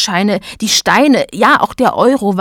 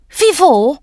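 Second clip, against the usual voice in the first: high-pitched cartoon voice; thin button's protest; 8 semitones higher, 4 dB louder than usual. A narrator's reading. Thin button questions his boss, protesting in high voice. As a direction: challenge it in a high voice.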